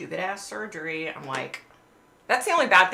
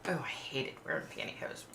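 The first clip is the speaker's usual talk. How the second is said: a soft breathy grumble of disgust; whispered gripe; 4 semitones lower, 13 dB quieter than usual. Real, not acted. Grumbling about old pantyhose.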